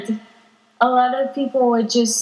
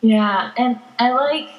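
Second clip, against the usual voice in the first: usual speaking voice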